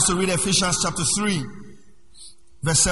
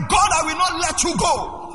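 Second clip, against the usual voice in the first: mock desperate prayer; 10 semitones higher, slower than usual. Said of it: "You will hear them say".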